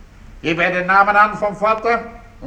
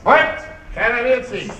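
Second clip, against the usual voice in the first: sharp, raised order to halt; authoritative shout; police command; 4 semitones higher than usual. Acted. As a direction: take charge of the room at once. Police commissioner bursts in.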